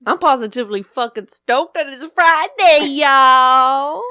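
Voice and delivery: loud, stoked cheer; excited. Real, not acted. Announcing to listeners it's Friday, loud and excited. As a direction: belt it out, thrilled it's Friday.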